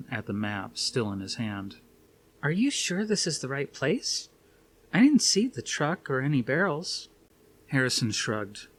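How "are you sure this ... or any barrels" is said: higher woman's voice, doubtful; Suzanne's voice. A narrator's reading. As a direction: voice Suzanne asking uncertainly.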